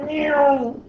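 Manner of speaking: high, slow, drawn-out "meow" like a revving motorbike; motorbike noise. Retold or imitated. Teacher imitates a fast motorbike to the class, high drawn-out engine whine. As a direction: mimic a bike engine winding up. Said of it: "It goes".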